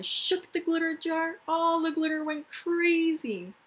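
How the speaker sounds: high, loud and excited; excited glitter jar. Shown as a demonstration. Teacher showing kids the glitter going crazy, excited. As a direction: make the shaken glitter sound wild.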